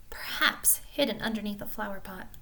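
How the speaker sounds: plain reading voice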